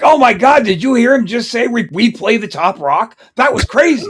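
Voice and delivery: over-the-top call-in radio excitement; mock radio DJ hype. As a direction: play a goofy radio host amazed on air. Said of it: "make sure you're not listening to a D J clip".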